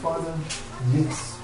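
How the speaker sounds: low, soft, pausing plea; tender intercession. Acted. Advocate speaking to the Father for Curry, tender.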